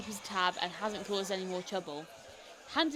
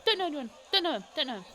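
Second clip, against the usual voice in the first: higher, quick repeated denials; nervous denial; 5 semitones higher, 4 dB louder, faster than usual. Acted. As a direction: deny it fast, jumpy. Nervous informant denying.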